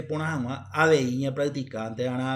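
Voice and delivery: ordinary talk, nothing marked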